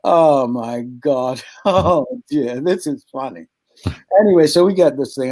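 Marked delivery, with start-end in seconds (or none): 0.0-3.5 amused. Retold or imitated. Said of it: "you're thinking".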